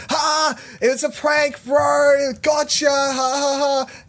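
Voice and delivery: high gloating prank voice; mocking prank laugh. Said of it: "And then they're like".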